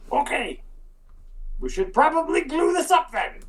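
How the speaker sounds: high squeaky kobold suggestion. Acted. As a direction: chirp it up high and eager. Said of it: "the one with the sauce says".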